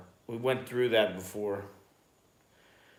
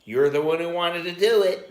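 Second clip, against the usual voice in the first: louder, higher mock scolding; imitating scolding wife; 7 semitones higher, 6 dB louder than usual. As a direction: throw it back as the unsympathetic wife. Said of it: "That's what she would say".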